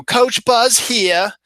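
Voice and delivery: slow, high Baltimore pitchman drawl; Coach Buzz impression. Pitchman impression for an auto parts commercial, thick Baltimore accent. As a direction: drag it out like a local Baltimore ad spokesman. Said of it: "So he'd go".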